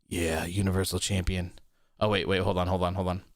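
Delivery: deep growling demon Vince. Retold or imitated. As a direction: voice him like a demon boss. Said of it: "adjust my demon voice".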